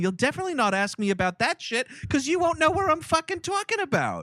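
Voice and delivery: high, brash put-on Boston accent; hammed-up Boston accent, fast and pushy; Johnny Callahan. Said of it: "you become Johnny Callahan".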